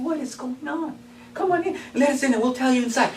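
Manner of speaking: high, fast and surprised; startled brother pig. Acted. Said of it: "He said".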